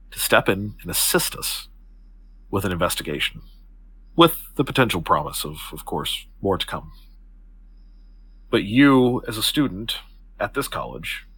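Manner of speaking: low, deliberate, formal offer with pauses; composed Octavia, persuasive. Acted. Octavia offers the student a place in her investigation, measured. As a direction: lay out the offer gravely, phrase by phrase.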